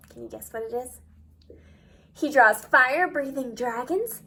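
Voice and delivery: usual speaking voice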